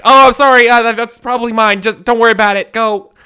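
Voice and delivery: high, loud, hurried apology; flustered villager. Acted. Villager loudly apologising to the adventurer for the coins. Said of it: "and he's like".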